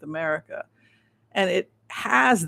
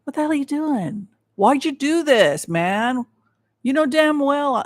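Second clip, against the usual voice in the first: Xi angry; 6 semitones higher, 4 dB louder than usual. Retold or imitated. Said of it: "He's very angry".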